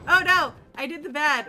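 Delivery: loud, high yelp of dismay; dismayed outcry. Real, not acted. Player to stream after a blunder, loud dismay. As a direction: cry out at your own blunder. Reacts to own in-game mistake.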